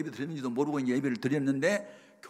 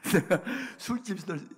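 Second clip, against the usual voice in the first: higher, slower words through laughter; laughing; 8 semitones higher, 4 dB louder than usual. Real, not acted. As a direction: start the story while still chuckling. Laughs into his next anecdote. (웃음 섞인 말투)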